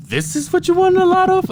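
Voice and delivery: mock disbelief. Installer asking in disbelief.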